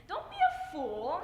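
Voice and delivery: loud, high, slow exclamation; exasperated advice, called out; scolding the television. Acted. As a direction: scold with drawn-out emphasis. Scolding a soap opera character.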